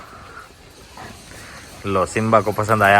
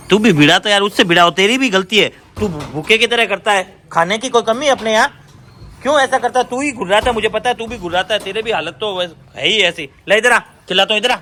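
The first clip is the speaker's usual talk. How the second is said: scolding the dog; 9 semitones higher than usual. Real, not acted.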